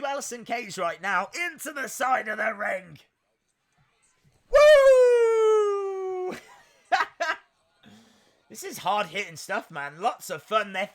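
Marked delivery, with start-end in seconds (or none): 4.5-6.4 very high, loud whoop of delight; real thrilled whoop; excited woo. Real, not acted. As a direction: let out a big wrestling woo. Celebrating the action.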